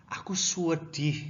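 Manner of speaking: "sedih" stretched as "suedih"; U inserted. Shown as a demonstration. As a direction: slip a long U after the s in "sedih". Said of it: "you're very sad".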